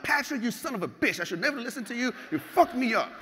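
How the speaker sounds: high, heated reenacted rant; high-pitched retold phone tirade; retold panicked outburst. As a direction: curse out the coach in mock panic. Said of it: "I'm totally fucking panicking".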